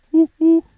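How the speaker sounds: high, loud two-note hoot; owl hoot. Shown as a demonstration. Streamer testing an owl call, high hooting. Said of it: "an owl doesn't sound like that".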